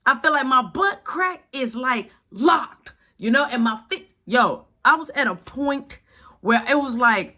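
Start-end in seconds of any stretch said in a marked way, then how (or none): none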